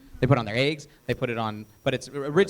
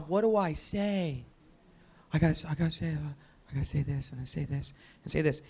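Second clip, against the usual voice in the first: softly mumbling a rehearsal to yourself; acting stuck in your own head, trailing softer; preoccupied self-talk; 6 dB quieter than usual. Presenter fixated on what to say, preoccupied quiet self-talk.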